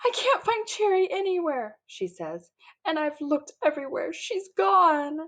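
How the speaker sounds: high, distraught little sister; upset girl who lost her doll; crying. A narrator's reading. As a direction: plead through tears, high and wobbly. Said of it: "Violet burst into the bathroom crying".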